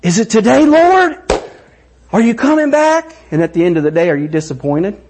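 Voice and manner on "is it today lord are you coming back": high, excited asking the Lord; childlike hope for Jesus' return; pitched up and eager; eager longing. Shown as a demonstration. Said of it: "Do you do that about the return".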